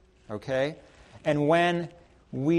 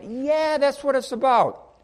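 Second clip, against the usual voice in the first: much higher, louder cheer; hammed-up party shout; 9 semitones higher, 5 dB louder than usual. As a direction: whoop it up like a celebration.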